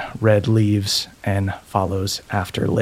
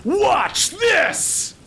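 high shouted battle cry; war cry. Acted. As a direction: scream it charging the enemy. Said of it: "he lets out a war cry".

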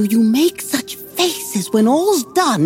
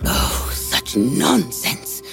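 scornful whisper; 12 semitones lower, 4 dB quieter, much slower than usual. Acted. Wife hushes her husband's raving.

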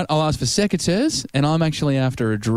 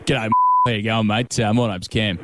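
low, broad Aussie tradie; easygoing Aussie ocker bloke; 4 semitones lower than usual. Pretend. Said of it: "put on a bit of an ocker voice".

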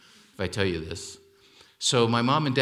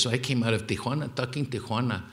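ordinary talk, nothing marked

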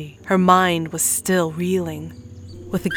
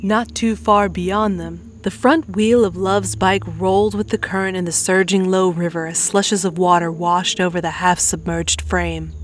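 plain reading voice

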